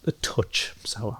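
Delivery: soft whispered aside. Real, not acted. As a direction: breathe it out as a quiet aside. Quibbling over the beer's sourness.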